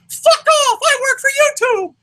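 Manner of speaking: loud squeaky falsetto brush-off; high-pitched mock rude worker; YouTube employee. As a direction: dismiss them in an absurdly high voice. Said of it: "Here's a YouTube employee".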